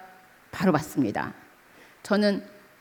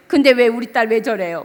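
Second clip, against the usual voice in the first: retold crying complaint; 7 dB louder than usual. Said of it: "막 울면서".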